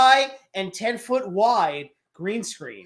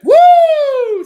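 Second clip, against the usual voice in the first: excited cheer; 15 dB louder than usual. Real, not acted. Cheering a correct trivia answer.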